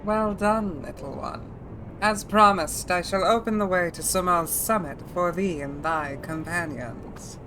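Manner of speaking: put-on throaty older woman dragon voice. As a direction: ancient dragon speaking with rasp. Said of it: "Feeling the Odile in that dragon voice?".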